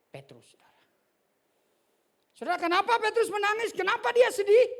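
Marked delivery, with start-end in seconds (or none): 2.3-4.7 much higher and louder, fast; preaching shout. Real, not acted. Preacher to congregation about Peter, fast high shout. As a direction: throw the question at the whole church.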